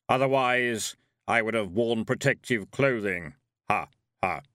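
heavy thundering voice. A narrator's reading. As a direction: let it rumble like distant thunder. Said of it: "said Death".

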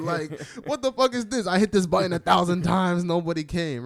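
loud high fed-up rant at the doctor; exasperated outburst. Retold or imitated. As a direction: let the doctor have it, half laughing.

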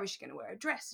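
normal talking voice

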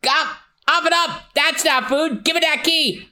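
high, urgent mock yelling; high-pitched exasperated orders; frantic scolding. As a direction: demand it back from a naughty dog. Hero yells at key-swallowing dog.

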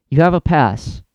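ordinary talk, nothing marked

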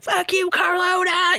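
high mock yell of an angry rival; hammed-up furious rival shouting down the phone. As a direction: imitate him screaming at her. Rival yelling back on phone.

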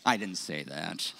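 low quiet comic disclaimer; deadpan aside. Real, not acted. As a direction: drop it flat as a quick disclaimer. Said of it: "aside after joke".

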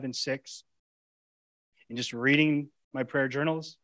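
usual speaking voice

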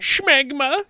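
very high, loud silly voice; teasing squeal. Real, not acted. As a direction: squeal the silly word to tease. Teasing repeat of silly word.

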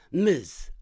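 clipped correction, short and cold; curt. Acted. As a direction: snap the correction back. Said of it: "I replied curtly".

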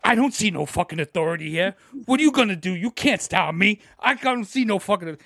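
loud, cocky challenge to authority; swaggering tough-guy defiance. Retold or imitated. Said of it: "like, a tough guy".

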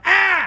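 high, loud startled scream. Retold or imitated. Friend on a trip jolted by the light, screaming high. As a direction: yelp like he's been jolted. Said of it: "He's like".

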